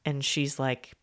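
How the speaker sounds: ordinary delivery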